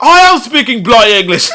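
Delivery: high, hammed-up English accent; put-on English accent, pitched well up; mock English accent. Imitating a classmate answering back, mock English accent. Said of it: "that's probably a better English accent".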